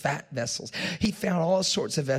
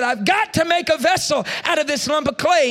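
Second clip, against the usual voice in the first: much higher, louder, forced determination; stubborn outburst; 12 semitones higher, 8 dB louder than usual. Retold or imitated. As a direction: shout your resolve at the clay. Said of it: "I got so frustrated".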